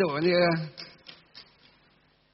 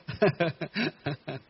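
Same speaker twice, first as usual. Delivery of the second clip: softer, stuttering mock sobs; demonstrated sobbing; 6 dB quieter than usual. Shown as a demonstration. Monk showing sobs of overwhelming joy, mock sobbing. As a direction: act out sobbing in short bursts. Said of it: "khóc, à, nức nở".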